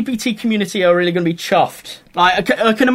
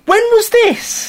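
baffled; slower than usual. Retold or imitated. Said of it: "you're like".

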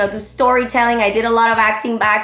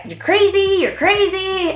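much higher, incredulous husband voice; mocking husband; 9 semitones higher than usual. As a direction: mimic the husband calling her crazy. Husband reacting to her audition.

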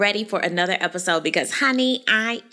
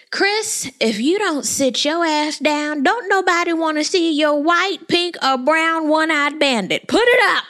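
scolding; 6 semitones higher than usual. Pretend. Scolding Chris on TV.

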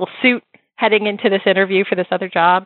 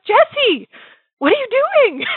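much higher, bright surprised greeting; Deborah voice. Retold or imitated. Deborah spotting Jessie in the ice cream line, high surprised voice. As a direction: pitch it way up like an excited old friend. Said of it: "She goes".

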